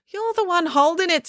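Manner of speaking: high, prim know-it-all voice; pedantic. A narrator's reading. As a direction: correct her smugly. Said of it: "said Finn pedantically".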